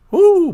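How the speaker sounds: high-pitched "woo"; short, high celebratory woo; excited whoop. Real, not acted. Reacting to a viewer's burger joke.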